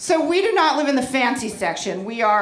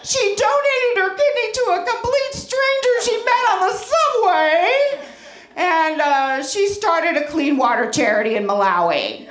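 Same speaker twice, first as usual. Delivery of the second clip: humble-bragging mother; 3 semitones higher than usual. Retold or imitated. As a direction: gush like a smug Facebook mom pretending to worry. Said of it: "something like this".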